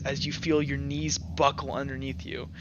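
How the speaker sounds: usual speaking voice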